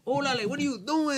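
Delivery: high, loud exaggerated Chicano accent; California Spanglish accent. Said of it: "that's what you call California Spanish".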